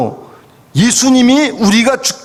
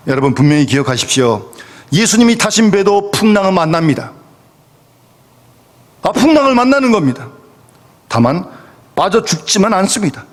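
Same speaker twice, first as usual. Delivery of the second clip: ordinary delivery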